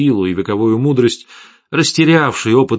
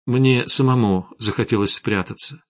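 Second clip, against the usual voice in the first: ordinary delivery, nothing marked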